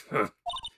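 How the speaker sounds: high gloating giggle; high cackle. Acted. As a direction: cackle in triumph. Villain's cackle.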